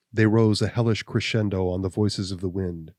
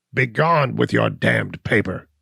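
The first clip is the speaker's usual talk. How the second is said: louder, slow oath; cried out; 2 semitones higher, 5 dB louder than usual. Acted. Gall rejecting the deed, louder and slower. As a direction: spit the words with an oath. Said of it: "he cried".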